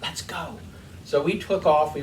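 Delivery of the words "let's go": much softer, whispered "Let's go.". Retold or imitated. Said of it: "She whispered in my ear".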